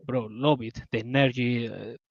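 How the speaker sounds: usual speaking voice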